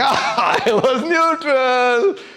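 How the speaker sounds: sarcastic. Shown as a demonstration. Teacher demos a sarcastic, drawn-out reading for students. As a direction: stretch "neutral" mockingly, as if nobody believes it. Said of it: "you don't know whether I'm saying".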